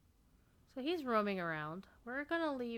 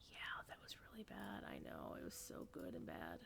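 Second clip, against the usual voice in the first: whisper; 13 dB quieter than usual. Real, not acted. Whispered asides about a bad joke.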